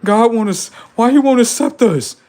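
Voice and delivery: high, loud mocking wail; mocking whiny upset people. Streamer mocking upset viewers, high loud whine. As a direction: whine it like a rejected crowd. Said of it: "They're like".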